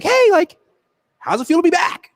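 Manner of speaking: high eager opener; forced cheery small talk. Retold or imitated. Reporter forcing small talk with a player, high cheery voice. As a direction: push a too-bright hello.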